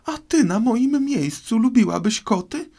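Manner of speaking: very high, loud indignant squeal; shrill mousy outburst; piercing, passionate cry. A narrator's reading. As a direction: shriek it as an outraged mouse. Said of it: "krzyknęła przeraźliwie i z pasją mysz".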